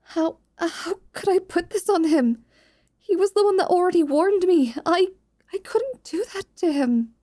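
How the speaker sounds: worried student Dolore. Acted. Dolore telling her friend she may be expelled, mildly anxious. Dolore torn about accusing Davius.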